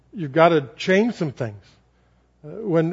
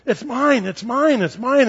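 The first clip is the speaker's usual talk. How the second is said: put-on greedy child; 6 semitones higher, slower than usual.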